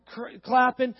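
normal talking voice

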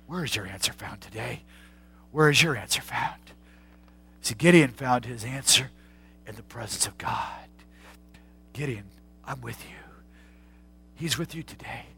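hushed preaching. Acted. Hushed altar-call moment.

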